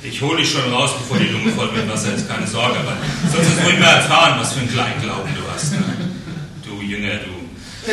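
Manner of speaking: fast, loud, laughing tease; hammed-up mocking reassurance, rushed; mock teasing Jesus. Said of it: "Nee, das macht er nicht". (Jesus spottet halb lachend, schnell und laut)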